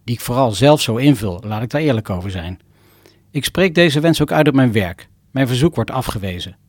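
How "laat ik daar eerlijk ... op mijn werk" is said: ordinary delivery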